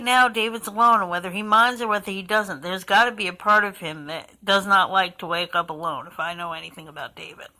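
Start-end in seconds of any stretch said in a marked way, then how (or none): 6.1-7.5 fast whispered afterthought; under-the-breath remark about David; whispered aside. Real, not acted. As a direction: drop to a quick whisper.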